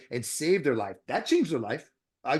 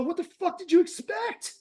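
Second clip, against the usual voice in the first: much higher, mock incredulous tirade; exasperated outburst; 11 semitones higher than usual. Dentist imitating himself scolding a smoking patient, exasperated and high. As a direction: throw it back at the patient in disbelief. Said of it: "I go".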